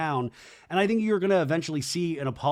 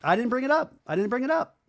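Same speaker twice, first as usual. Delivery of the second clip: mock defensive; 9 semitones higher, faster than usual. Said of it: "And Jen's like".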